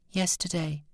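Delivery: put-on high, soft little boy; small child voice. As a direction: answer in a small, timid child's voice. Frightened small boy answers.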